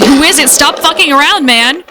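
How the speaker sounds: restrained shout at a prowler; mild narrated yell. A narrator's reading. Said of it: "We keep yelling outside".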